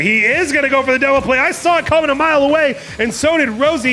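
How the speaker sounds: hyped commentator shouting the play; excited call. Real, not acted.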